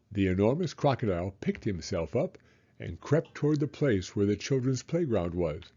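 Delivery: plain reading voice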